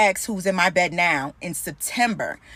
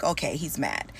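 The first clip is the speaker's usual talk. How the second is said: muttered thought; 6 semitones lower, 7 dB quieter than usual. Retold or imitated. Thinking to herself about boyfriend.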